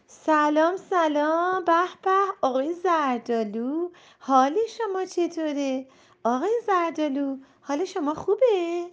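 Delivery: high, loud chirpy chorus; excited cherries. Acted. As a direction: greet him like a bunch of giddy kids. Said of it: "گیلاسا همه با هم گفتن".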